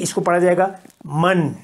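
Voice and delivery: usual speaking voice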